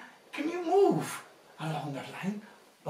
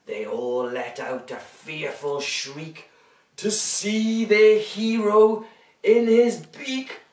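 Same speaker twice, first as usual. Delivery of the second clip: lively storybook reading; reading to children; 3 semitones lower, 7 dB louder than usual. A narrator's reading. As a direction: read it big for little ones.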